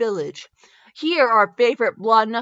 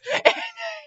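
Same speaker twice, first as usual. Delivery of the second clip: big burst of high laughter; 3 dB quieter than usual. Real, not acted. Loud laugh after joke.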